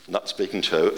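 ordinary talk

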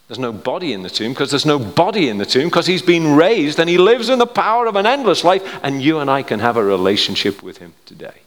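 loud, high, impassioned proclamation; full-force preaching. Real, not acted. Preacher proclaiming the resurrection to congregation, full-force preaching. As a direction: preach it out, rising in pitch and force.

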